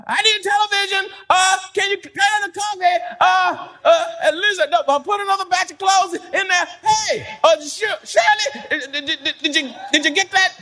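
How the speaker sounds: high, calling out across the house; hammed-up loud voice hollering at family; loud talker. Preacher mimicking a loud spouse at home, hammed-up high calling. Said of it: "you just talk loud".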